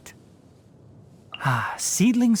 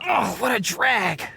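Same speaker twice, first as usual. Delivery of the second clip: slow, raised groan of annoyance; exasperated groan; 4 semitones higher than usual. Acted. Forest watcher grumbling at a nuisance, exasperated groan. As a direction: drag out the complaint. Complaining about a nuisance in play.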